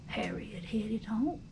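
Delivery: ordinary delivery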